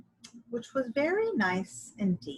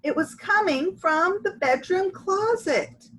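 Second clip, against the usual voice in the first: ordinary delivery, nothing marked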